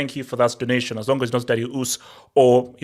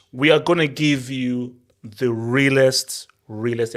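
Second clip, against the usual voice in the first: slow hype; slower than usual. Real, not acted. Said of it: "drawn-out hype line".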